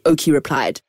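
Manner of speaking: plain reading voice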